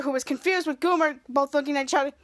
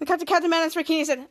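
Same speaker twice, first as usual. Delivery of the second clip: usual speaking voice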